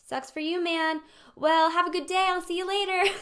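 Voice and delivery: high, loud, careless brush-off; breezy sympathy. Acted. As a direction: toss it off cheerily and leave. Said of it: "sympathy says".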